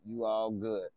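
ordinary talk

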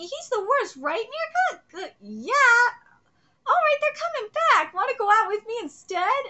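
voiced manga girls.

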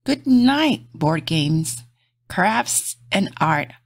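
gentle bedtime storybook read; reading to children. Acted. Reading a bedtime picture book aloud to kids, soft and gentle. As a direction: lull little listeners toward sleep.

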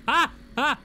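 two short high yelps; quick cries of shock; startled yelps. Real, not acted. Driver jolted at the wheel, startled yelps.

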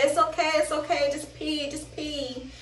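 soft, fading reassurance; soothing. Retold or imitated. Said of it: "She said".

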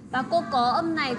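normal talking voice

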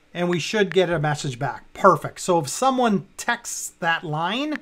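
ordinary talk, nothing marked

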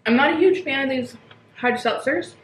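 talking behind hand while chewing. Real, not acted. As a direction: mouth partly full of food. Seen 0: Bite at -2.0; chewing, hand covers mouth until 2.1.

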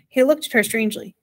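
ordinary delivery

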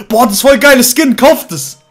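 ordinary talk, nothing marked

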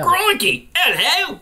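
high-pitched mock Australian accent; Australian attempt. Host retries his voice, exaggerated high Australian. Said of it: "Australian is up more".

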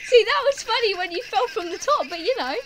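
high and loud, just out of a laughing fit; high, amused outburst. Real, not acted. Teasing a friend about a fall, giddy and loud. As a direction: blurt it out, still giddy from laughing.

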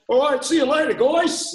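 high-pitched, called-out Aussie goodbye; hammed-up Australian send-off; pretend Australian. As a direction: call a farewell in a put-on Australian accent. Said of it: "I pretend to be Australian".

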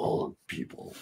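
whispered echo; breathy whispered echo. Pretend. Echoes whispered.

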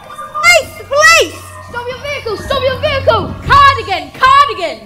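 calling out to pull over; shouting. Acted. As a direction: shout a police order. Calling out as police.

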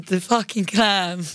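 normal talking voice

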